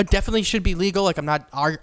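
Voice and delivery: ordinary talk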